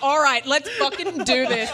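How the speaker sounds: loud, slow, pumped-up self; pumped-up hype. Retold or imitated. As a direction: psych yourself up, loud and drawn out. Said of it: "I was like".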